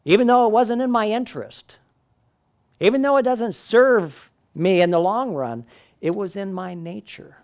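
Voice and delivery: high, emphatic scorpion confessing his nature; resigned scorpion. Acted. Scorpion voiced in fable.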